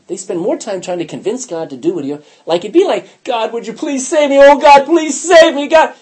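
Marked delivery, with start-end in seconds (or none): 3.2-5.9 high, loud begging prayer; mock desperate pleading. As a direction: beg God in hammed-up desperation, building louder. Mocking repetitive desperate prayer.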